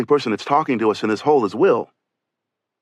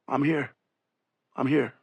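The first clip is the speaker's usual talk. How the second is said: softer, groggy, barely conscious reply; 6 dB quieter than usual. Retold or imitated. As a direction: answer weakly as if half awake. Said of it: "in a groggy voice say".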